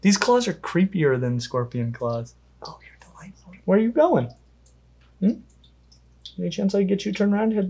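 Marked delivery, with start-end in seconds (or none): 2.6-3.5 very soft whisper to a pet; hushed. Real, not acted. Handler to the vinegaroon, hushed whisper. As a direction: whisper it tenderly under your breath. Murmuring to the animal.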